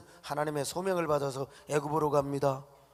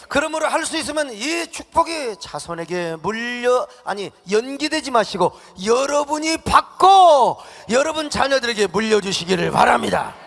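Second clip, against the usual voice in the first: higher and louder, calling out the blessing; fervent preaching shout; 7 semitones higher, 8 dB louder than usual. Real, not acted. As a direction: preach it at full force to the room. Preacher exhorts congregation at full force. (열정적으로 외치는 설교)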